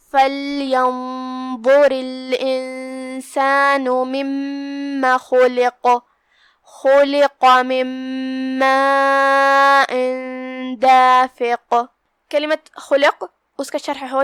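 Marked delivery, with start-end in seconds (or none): 0.1-11.8 slow, drawn-out chanted recitation; Quran recitation. Acted. Teacher opening the tajweed lesson, slow chanted recitation.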